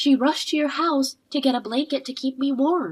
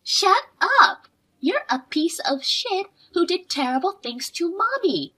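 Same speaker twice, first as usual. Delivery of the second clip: angry yell; 5 semitones higher than usual. Acted. Child screaming at the woman who hurt Mommy. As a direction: slam the first words, then keep the anger burning. Girl yelling at the villain.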